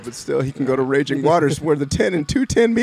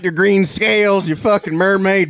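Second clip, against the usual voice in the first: mock angry local; 5 semitones higher than usual. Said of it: "I said".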